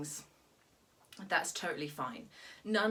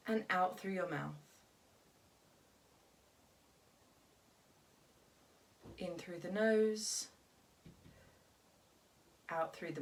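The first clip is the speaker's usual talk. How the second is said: guided meditation. Acted. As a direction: calmly lead them through each breath, leaving room to breathe. Leads a breathing exercise.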